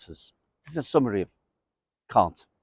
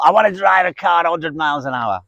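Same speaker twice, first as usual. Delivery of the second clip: mock thrill-seeker, higher and faster; slave to impulse; 4 semitones higher, faster than usual. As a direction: blurt it like someone ruled by impulse. Voices a slave to passions.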